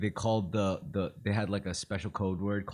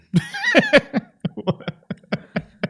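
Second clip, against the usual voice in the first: high burst of loud laughter; 11 dB louder than usual. Real, not acted. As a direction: crack up loudly.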